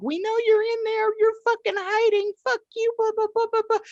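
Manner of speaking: very high squealing mock yell; mock screaming. Women banging on neighbor's door, high mock yell. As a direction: screech it like angry women at the door. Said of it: "they were yelling".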